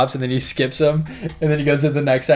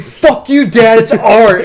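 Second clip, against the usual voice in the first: put-on high, loud teenage snap at dad; bratty outburst; 8 semitones higher, 9 dB louder, slower than usual. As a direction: yell it back at dad like a sulky kid. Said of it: "He's like".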